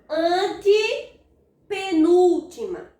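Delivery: "antepenúltima" split slowly after "ante"; drawn-out counting. Shown as a demonstration. Teacher repeats the count. (contagem pausada e alongada)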